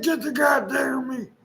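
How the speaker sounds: hammed-up angry shout at the little boy; screaming. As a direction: bark the order like a furious mother. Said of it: "she was screaming at him".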